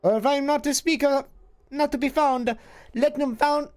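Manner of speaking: lightly voiced old crone, cryptic; a hint of a hissing witch, riddling; half-voiced hag speaking in riddles. GM voicing crone Ravel.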